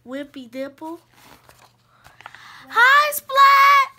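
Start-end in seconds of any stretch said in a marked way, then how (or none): 2.7-3.9 high, loud, drawn-out hello; shouted class greeting. Acted. Class greeting the new cat, shouting brightly together. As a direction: shout it like a whole class at once.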